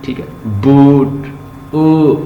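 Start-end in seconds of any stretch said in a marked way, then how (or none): 1.7-2.1 a long drawn "ऊ" from the back of the tongue; long oo demo. Shown as a demonstration. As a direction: stretch the "ऊ" out. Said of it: "अपनी sound निकालें".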